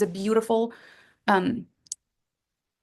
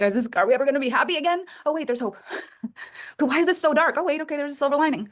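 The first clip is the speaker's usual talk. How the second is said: fast, high swings between despair and relief; 5 semitones higher, faster than usual. Retold or imitated. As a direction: playfully act out the emotional rollercoaster. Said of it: "this call kinda goes like this".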